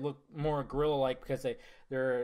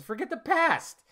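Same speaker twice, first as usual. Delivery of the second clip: Ben's line; 10 semitones higher, 3 dB louder, slower than usual. Retold or imitated. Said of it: "He says".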